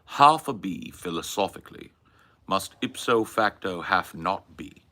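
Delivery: mannered British intellectual, pausing between phrases; Hitchens. Acted. Philosophizing on request about half a bee, imitated British intellectual. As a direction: hold forth like a learned British debater. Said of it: "Please do a Hitchens".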